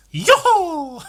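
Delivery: high loud Mario yelp; Mario noise. Retold or imitated. Comedian doing a Mario noise at the mirror. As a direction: bark a Mario jump call. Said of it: "Exclusively making Mario noises".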